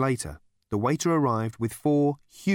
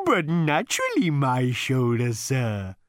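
high, slow, contented cow; contented mooing; 3 semitones higher, 4 dB louder, slower than usual. A narrator's reading. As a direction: moo it out, pleased. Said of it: "mooed the animal contentedly".

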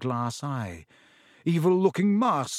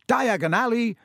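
high, raised call; shouted; 8 semitones higher, 4 dB louder than usual. A narrator's reading. Boy announcing where he goes in the fire, shouted. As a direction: call the destination out loudly.

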